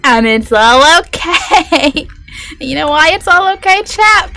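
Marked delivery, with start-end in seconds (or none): 2.6-4.3 giddy and higher, teasing chat; high, giddy question to chat. Real, not acted. Streamer teasing chat about being okay, giddy. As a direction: tease chat with a giddy lift.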